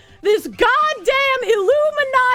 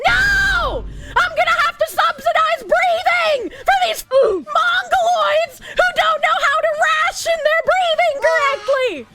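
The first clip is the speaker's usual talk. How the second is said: furious shout; 7 semitones higher than usual. Real, not acted. Streamer rages to chat about subsidizing others, furious shouting. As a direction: shout it in outraged disbelief.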